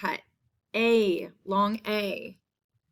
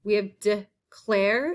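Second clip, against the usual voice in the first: slow modelling; slower than usual. Shown as a demonstration. Teacher slowly modelling a spelling word. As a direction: declare said slowly, the clare drawn out. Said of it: "That's in the second half".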